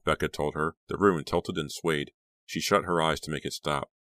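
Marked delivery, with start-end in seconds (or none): none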